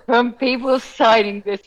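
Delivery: laughing through the words, slowed; amused disbelief, laughing as she says it; laughing while telling the punchline. Real, not acted. Laughing at the email flood.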